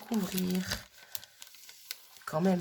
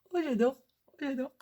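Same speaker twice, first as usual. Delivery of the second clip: higher, gushing "j'adore"; excited gushing; 7 semitones higher than usual. Real, not acted. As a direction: squeal it with delight. Gushing over gifts.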